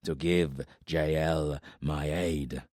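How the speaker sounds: low, very slow, heavy voice of a giant warlord; menacing warlord from the shadows. Acted. Giant warlord speaks to Corbin from the shadows, low and slow. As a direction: let each word drop slow and low like a giant's threat. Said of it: "Ildair said".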